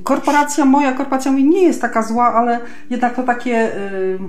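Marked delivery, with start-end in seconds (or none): none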